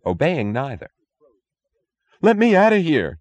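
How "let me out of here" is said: much higher, louder terrified cry; panicked scream. A narrator's reading. Youngest guard screaming as he bolts for the door. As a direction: scream to escape the room. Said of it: "screamed the youngest".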